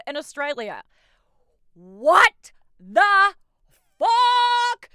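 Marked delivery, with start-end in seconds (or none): 1.7-4.9 loud, much higher shocked outburst. Real, not acted. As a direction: scream out the disbelief. Shock at scandal news.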